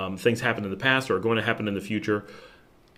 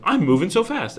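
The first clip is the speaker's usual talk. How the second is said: higher-pitched, upbeat mock brag; mock excitement; 9 semitones higher, 4 dB louder than usual. Imagined new learner boasting of quick progress, mock excited. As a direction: play a learner thrilled at their progress. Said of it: "you're gonna be like".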